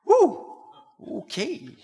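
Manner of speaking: high whoop, then a slow okay; mock-startled "woo", easing into okay; taken aback. Reacting to her walking off.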